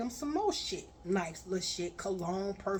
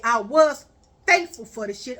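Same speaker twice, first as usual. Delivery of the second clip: louder and faster, scolding; angry rant; 12 dB louder than usual. Retold or imitated. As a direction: tear into him, raising your voice. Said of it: "I say".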